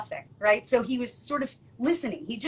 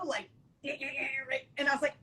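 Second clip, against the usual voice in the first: usual speaking voice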